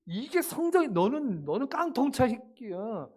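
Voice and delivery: hammed-up, high-pitched telling-off; mock tirade over grades, drawn out at the end; harsh parental scolding. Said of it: "엄마, 아빠가 막 세게". (부모가 세게 혼내는 과장된 흉내)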